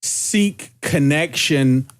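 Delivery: slow, measured, with pauses between phrases; deliberate, halting delivery; careful wording. Real, not acted. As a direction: choose each word carefully, drawing it out. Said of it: "I wanna be careful with my wording".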